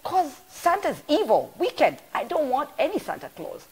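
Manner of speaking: put-on a little girl firmly refusing Santa; six-year-old daughter. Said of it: "So she said".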